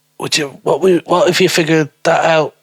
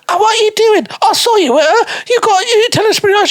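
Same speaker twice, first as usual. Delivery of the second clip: squeaky mock girl. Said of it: "And then she's like".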